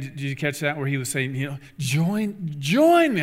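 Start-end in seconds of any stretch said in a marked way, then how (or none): 1.8-3.2 put-on high, loud Australian accent; an attempt at Australian enthusiasm; imitating Steve Irwin's Australian accent; Steve Irwin impression. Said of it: "I can't do the Australian accent".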